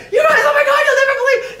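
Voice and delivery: very high, loud excited yelling; imitating a freaked-out guest shouting to his friends; frantic shout. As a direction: burst out desperate to tell everyone. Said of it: "he's like".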